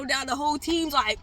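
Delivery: ordinary talk, nothing marked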